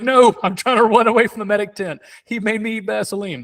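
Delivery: high-pitched, comically panicked pleading; mock frantic protest. Runner fleeing the medic tent to onlookers, mock frantic protest. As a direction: protest in hammed-up alarm.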